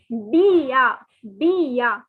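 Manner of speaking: bien said as bee-a, nasal end; teacher models bien with the n silent. Shown as a demonstration. Teacher shows students how to say bien. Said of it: "the pronunciation is".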